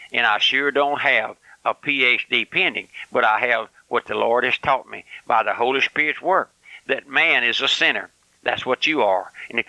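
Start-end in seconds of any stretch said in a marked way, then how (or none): none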